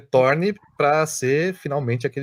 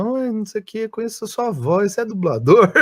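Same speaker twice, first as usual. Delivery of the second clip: imitating fan; 7 semitones higher than usual. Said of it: "a pessoa".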